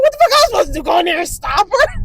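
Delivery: loud, high mock-indignant protest; indignant retort. Grandma answers auntie about the piercing, loud mock indignation. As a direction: imitate an exasperated grandma defending herself loudly. Grandma defends herself to aunt, retold.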